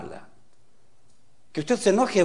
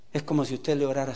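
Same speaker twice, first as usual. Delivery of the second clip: usual speaking voice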